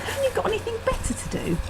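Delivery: high, fast teasing jab; mocking. Wife teasing husband about his hobby, high and quick. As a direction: needle him with a high quick quip.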